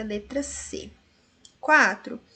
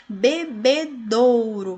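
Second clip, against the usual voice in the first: Bebedouro said slowly and louder, each syllable spelled out; slow syllable demo; 6 dB louder, slower than usual. Shown as a demonstration. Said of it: "nós temos a presença do ditongo aqui no do".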